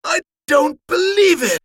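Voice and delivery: seventy-fifth birthday reunion; barely contained emotion. Acted. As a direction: overflow despite trying to hold back. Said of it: "trying to contain his emotions".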